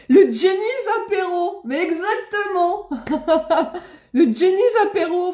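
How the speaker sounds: high, loud, breaking into real laughter; delighted laughing. Real, not acted. Streamer loving a viewer's apéro idea, laughing delight. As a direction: exclaim it through happy laughs.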